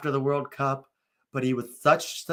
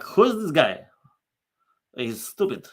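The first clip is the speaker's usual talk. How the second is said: put-on Russian accent, pitched up then dropping; Zlatan; 3 semitones higher, 3 dB louder than usual. Star striker dismissing the coach, mock Russian accent. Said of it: "I don't know why Zlatan's Russian".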